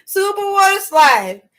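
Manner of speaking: gleeful answer; excited. Real, not acted. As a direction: shout "Super water slide" with a rush of joy.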